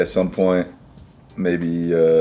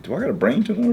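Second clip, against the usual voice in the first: worried self-quote; 14 semitones higher, faster than usual. Retold or imitated.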